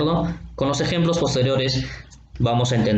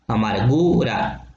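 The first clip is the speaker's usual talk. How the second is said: "Amargura" slowed, with a long "gu" before "ra"; stressed-syllable demo; 2 semitones lower, slower than usual. Shown as a demonstration. Teacher showing students the accented U, "Amargura" drawn out on "gu". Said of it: "La última vocal acentuada es la U".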